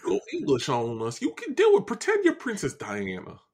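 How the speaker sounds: swinging, hammed-up Southern cartoon voice; blustery Southern rooster; Southern gentleman. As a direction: coax them in a big Southern put-on voice. Keeps up Foghorn voice.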